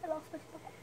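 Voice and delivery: ordinary talk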